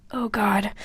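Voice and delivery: whisper. Real, not acted.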